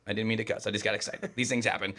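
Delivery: a hushed mock apology to Mom; mock sheepish. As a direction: mumble it like a kid caught cussing.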